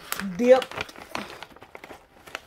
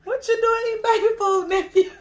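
laughing; 10 semitones higher, 6 dB louder than usual. Real, not acted. Teasing about baby food.

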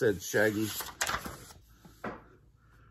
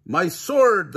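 high, loud cry of dismay; knight's cry; 6 semitones higher, 6 dB louder than usual. A narrator's reading. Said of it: "the knight cried".

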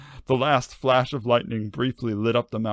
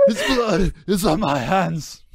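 high ragged confession; hammed-up anguished cry, high-pitched; ragged Judas; 8 semitones higher, 4 dB louder than usual. A narrator's reading. Judas confessing the murder to the voice, high ragged cry. Resumes Judas after breaking off.

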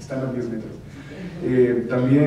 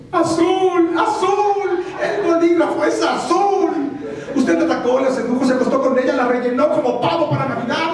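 much higher, loud frantic yelling; Jim Carrey voice; 7 dB louder than usual. Retold or imitated. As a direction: scream it like a manic lawyer. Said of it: "mentiroso, mentiroso".

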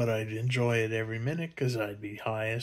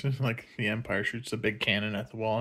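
ordinary talk

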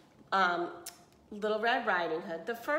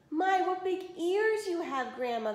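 croaking; 9 semitones higher than usual. A narrator's reading. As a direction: croak it out weakly. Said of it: "the wolf croaked".

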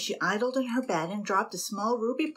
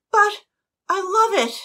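much higher, loud plaintive cry; pleading protest; 12 semitones higher, 10 dB louder than usual. A narrator's reading. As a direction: beg for her pet. Annabel protesting.

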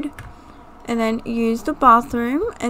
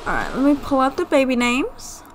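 ordinary delivery

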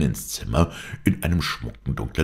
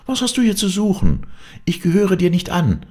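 loud, high-pitched indignation; angry; 13 semitones higher, 4 dB louder than usual. A narrator's reading. Heroine shielding her lover from the intruder, furious. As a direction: stand up to the intruder, voice raised high. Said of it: "mehr vor Zorn über die Störung".

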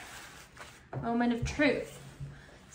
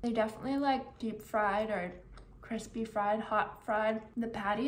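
speaking with food in mouth; mid-bite mumbling; chewing while talking. Real, not acted. Seen 3: Bites burger at -5, chews, then talks with some food still in mouth.

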